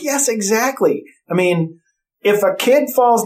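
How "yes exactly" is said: slow, raised-pitch retold agreement; mildly hammed-up vindication; emphatic agreement. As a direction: draw it out high, acting out agreement. Retelling emphatic agreement with mom.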